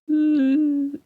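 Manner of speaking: high, steady held "ooh"; speaker feedback whine. Retold or imitated. Comic showing how the speakers went, a high feedback whine. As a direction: imitate feedback squealing from the speakers. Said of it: "'cause they'd go".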